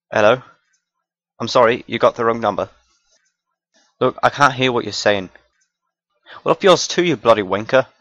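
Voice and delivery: put-on a British accent snapping back at a rude caller. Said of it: "random accent skits".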